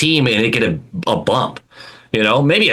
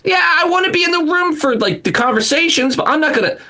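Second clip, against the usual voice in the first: high-pitched mock owner insistence; pretend hands-off owner. Podcaster imagining owning the Bruins, high mock hands-off insistence. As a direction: protest in a much higher pretend-owner voice. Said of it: "I'd like to pretend like".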